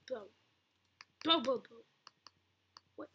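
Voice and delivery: ordinary talk, nothing marked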